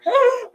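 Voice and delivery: a high, loud gasp of embarrassment; mock-shocked gasp; sharp gasp. Real, not acted. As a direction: suck in air, mortified. Reacting to embarrassing memory.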